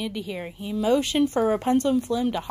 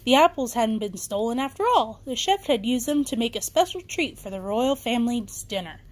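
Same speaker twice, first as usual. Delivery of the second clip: ordinary delivery, nothing marked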